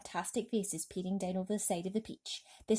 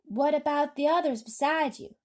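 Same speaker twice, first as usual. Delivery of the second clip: high, loud shouted question; 7 semitones higher, 6 dB louder than usual. A narrator's reading. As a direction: shout up to the boy. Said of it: "shouted the chief of police".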